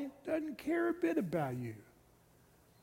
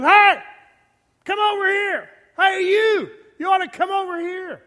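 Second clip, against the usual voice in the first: high, loud, brash yell; obnoxious yelling; 9 semitones higher, 10 dB louder than usual. Retold or imitated. Woman Folly yelling at passers-by from her house, obnoxious shout. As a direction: yell it out like a pushy street hawker. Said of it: "the woman Folly just sits at her house and goes".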